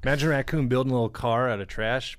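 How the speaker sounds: normal talking voice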